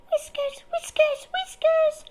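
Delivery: high, tiny squeaking chorus of mice; squeaky mice. Acted. Little mice answering their teacher with a high squeak. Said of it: "they all squeaked".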